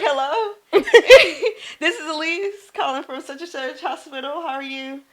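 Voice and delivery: high, polite professional phone greeting with a nervous laugh. Retold or imitated. Nurse cold-calling a patient's daughter, high and polite. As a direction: put on your friendly hospital-caller voice.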